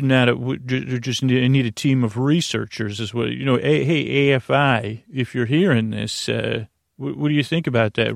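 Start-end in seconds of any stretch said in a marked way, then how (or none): none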